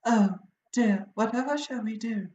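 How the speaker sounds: a dainty little falsetto; genteel fretting lady; light falsetto. Shown as a demonstration. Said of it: "giving it a little falsetto of".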